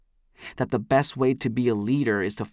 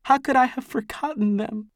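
wailing; 12 semitones higher than usual. A narrator's reading. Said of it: "she wails".

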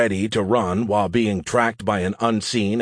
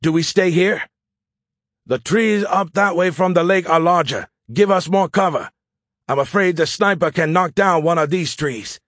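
higher, urgent soldier's voice; soldier under fire; 8 semitones higher, 4 dB louder than usual. Acted. Officer to squad sheltering from a sniper, urgent. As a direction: speak up tensely like a pinned-down officer.

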